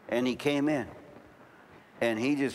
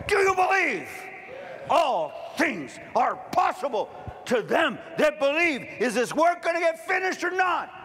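full-force preaching; 11 semitones higher than usual. Acted. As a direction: thunder it at the congregation. Sermon climax, full-force preaching.

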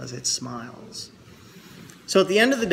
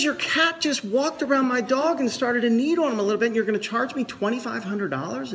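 indignant client; 8 semitones higher than usual. Retold or imitated. Client protests vet's bill.

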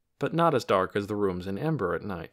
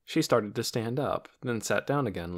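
ordinary delivery, nothing marked

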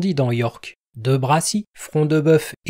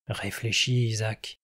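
softer and lower, close to his ear; an outlaw murmuring into the old man's ear; 4 semitones lower, 7 dB quieter than usual. Acted. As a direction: murmur it privately. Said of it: "murmura Locksley à son oreille".

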